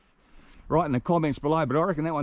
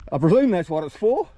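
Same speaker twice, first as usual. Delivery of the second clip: higher, louder, laughing through it; 6 semitones higher, 5 dB louder than usual. Real, not acted. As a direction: say it while cracking up. Laughs at clothes rack.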